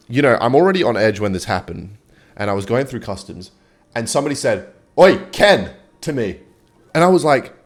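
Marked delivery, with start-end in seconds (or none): 5.0-5.7 airport guard shouting his name across the crowd, loud call. Retold or imitated. Guard calling to a passing traveller, loud hail. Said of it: "he screams my name out".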